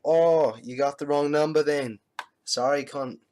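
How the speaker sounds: loud Australian bloke brushing him off; Australian accent. Retold or imitated. Telling the caller he has the wrong number, loud Australian accent. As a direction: boom it out in a brash Australian accent. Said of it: "since we were talking like Australians".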